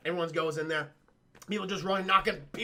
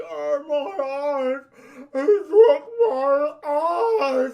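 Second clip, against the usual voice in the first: very slow, high, loud wail; agonized mock wail; 7 semitones higher, 9 dB louder, much slower than usual. Blinded man falls on the floor, wailing in mock agony. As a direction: draw the words out in hammed-up agony.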